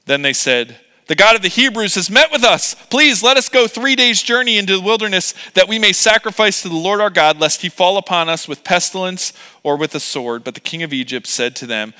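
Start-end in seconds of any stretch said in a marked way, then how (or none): 1.1-10.4 higher, raised pleading reading voice; impassioned scripture reading. Acted. Moses and Aaron petition the king of Egypt, pleading. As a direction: read it as an urgent plea. Reads scripture dialogue aloud.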